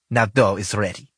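put-on loud, blunt thick Russian accent. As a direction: bark it in a heavy Russian accent. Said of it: "her thick Russian accent".

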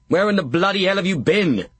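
high, loud exasperated guard; shouting. Acted. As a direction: bark it down from the wall. Sentry yells at the fool.

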